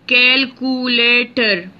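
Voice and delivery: Calculator spaced as cal-cu-la-tor; slow syllable by syllable. Shown as a demonstration. Teacher showing students the syllables, slowly spaced. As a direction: count out Calculator beat by beat. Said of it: "Four syllable word".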